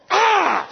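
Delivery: high imitated little-kid cry; mock toddler yell. Dad imitating his slapping daughter, high mock yell. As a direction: yelp like a toddler mid-slap.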